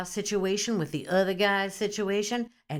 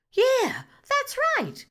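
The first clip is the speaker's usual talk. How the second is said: squealing agreement; slower than usual. Acted. Acted phone gossip, squealing.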